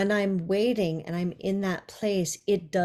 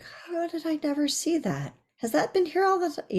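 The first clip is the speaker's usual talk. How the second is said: surprised discovery; 10 semitones higher than usual. Retold or imitated. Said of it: "you're like".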